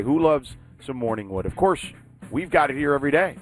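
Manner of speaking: normal talking voice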